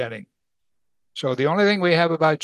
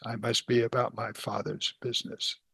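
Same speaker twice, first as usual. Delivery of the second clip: soft quote; 4 semitones lower, 9 dB quieter than usual. Acted. Quoting Jesus softly.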